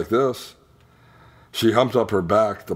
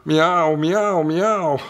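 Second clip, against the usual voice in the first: high meows building louder; louder cat cry; 9 semitones higher, 4 dB louder, much slower than usual. A narrator's reading. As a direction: wail louder like a kitten stuck in a tree. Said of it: "Then louder and louder".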